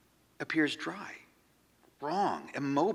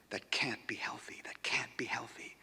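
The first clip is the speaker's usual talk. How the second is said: whispered to himself; 9 dB quieter than usual. Acted. Repeating it to himself in bed.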